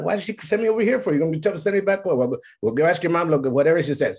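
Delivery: put-on high, fast exasperated kid voice; frustrated kid. Boy complaining about being sent back and forth, exasperated. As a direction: sputter like a fed-up boy. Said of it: "I'm just like".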